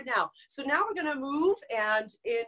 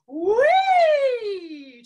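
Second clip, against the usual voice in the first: high, loud sliding whee; like a kid on a swing; joyful swing cry in head voice; 10 semitones higher, 10 dB louder than usual. Shown as a demonstration. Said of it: "We go like this".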